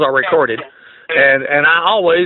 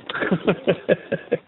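silent wheezing laugh; breathy mocking laugh; 8 dB quieter than usual. Real, not acted. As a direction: laugh under your breath at him. Laughing at the scammer.

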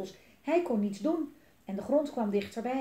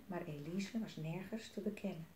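ordinary delivery, nothing marked

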